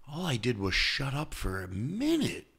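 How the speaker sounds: relaxed relief. Retold or imitated. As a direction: exhale into calm wonder. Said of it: "if they really go".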